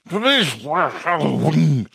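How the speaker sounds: high, slow growl that garbles the words; garbled werewolf speech. A narrator's reading. Werewolf tries explaining to police, growling the words garbled. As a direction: attempt speech through a wolf's muzzle.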